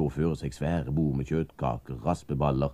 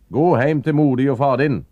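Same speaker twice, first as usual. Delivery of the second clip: much louder, higher shout through a closed door; 12 semitones higher, 12 dB louder than usual. Acted. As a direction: bark him away from behind the door. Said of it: "ropte Gaspar Sanomir bak den lukka døra". (roper høyt bak den lukka døra)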